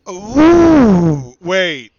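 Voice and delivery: loud, high, delighted ooh; light up at the picture; excited reaction to a picture. Real, not acted.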